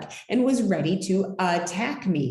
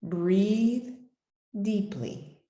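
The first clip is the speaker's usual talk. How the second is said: plain reading voice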